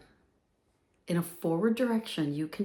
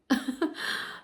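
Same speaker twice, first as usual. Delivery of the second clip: short laugh; 5 semitones higher, 4 dB quieter than usual. Real, not acted. Breathy laugh after joke.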